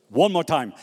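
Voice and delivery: usual speaking voice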